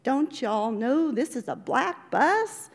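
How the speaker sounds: a hint of the woman's Southern accent; mild mock Southern accent. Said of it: "she said in her accent".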